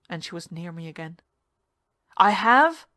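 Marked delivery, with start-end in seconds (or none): 2.2-2.9 higher, much louder yell; indignant loud yell. Acted. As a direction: shout it back in disbelief. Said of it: "I yelled".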